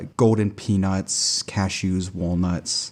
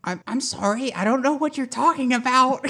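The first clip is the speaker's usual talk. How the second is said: put-on high old lady voice.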